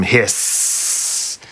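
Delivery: long drawn-out snake hiss; fearsome hiss. A narrator's reading. Snake adds drama to the monster's announcement, fearsome hiss. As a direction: stretch the final s into a hiss. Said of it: "with a fearsome".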